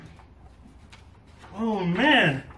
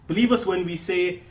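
ordinary talk

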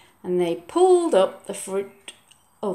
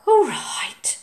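sighing; 10 semitones higher than usual. Acted. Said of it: "sighed Oliver".

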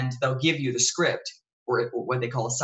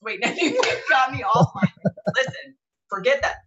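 laughing; 11 semitones higher, 4 dB louder than usual. Real, not acted. Laughing, trying to get back on track.

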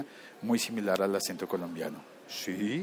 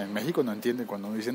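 ordinary delivery

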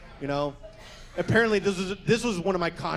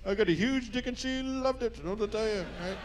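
pitched-up mock voice of the guy, playing him dumb; mocking the guy's voice; 5 semitones higher than usual. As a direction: ham up the guy's cocky answer. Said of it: "He's like".

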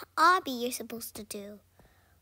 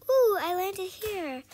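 usual speaking voice

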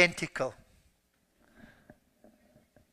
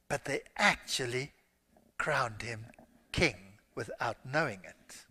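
hushed; 7 semitones lower, 9 dB quieter than usual. Real, not acted. Preacher drops to a hush.

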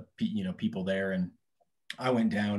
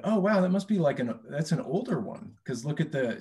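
excited salesperson; 7 semitones higher, 4 dB louder than usual. Retold or imitated. Retold salesperson's excited remark.

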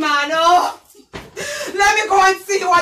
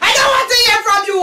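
fast, loud, higher scolding; queen silencing a servant, angry shout; 5 semitones higher, 4 dB louder, much faster than usual. Acted.

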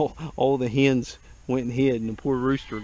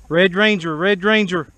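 calling out; 6 semitones higher, 9 dB louder than usual. Real, not acted. Giving the signal call.

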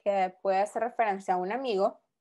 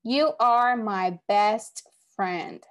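drawn-out, louder read of the sentence; very slow example reading; 4 dB louder, much slower than usual. Shown as a demonstration. Teacher reading the next example to students, very slow and projected. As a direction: stretch it out and project to the class. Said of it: "Next one".

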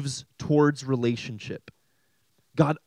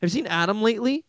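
quick, high mock-casual question; puzzled Trinity member; 7 semitones higher, faster than usual. God the Father asks about Adam, high clueless voice. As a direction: pitch it up, innocently clueless. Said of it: "they're like".

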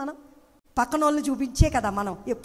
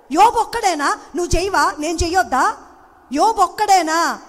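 loud, high, fervent preaching; full-voiced pulpit exhortation; preaching at full force; 6 semitones higher, 6 dB louder than usual. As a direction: lift the congregation with a rising sermon cry.

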